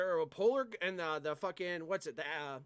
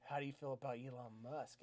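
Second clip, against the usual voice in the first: creepy close-up customer; 6 semitones lower, 11 dB quieter than usual. Retold or imitated. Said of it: "he's like".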